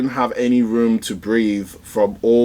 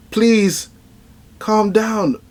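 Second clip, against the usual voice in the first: high, pleading plea; 11 semitones higher, much slower than usual. Retold or imitated. Deku pleading with the crowd, high voice. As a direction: beg them to calm down.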